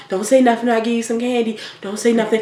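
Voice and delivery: put-on quietly buy a child's silence; imitated secretive coaxing; hushed bribe. Acts adult bribing a child's silence.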